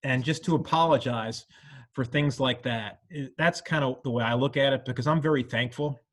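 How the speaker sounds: normal talking voice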